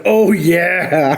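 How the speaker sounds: playful catchphrase holler, not full volume; mock shout. Narrator bursting out through the wall, mild mock shout.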